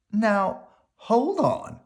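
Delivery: Mama cutting in to stop Papa; Mama interrupting. A narrator's reading. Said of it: "interrupted Mama".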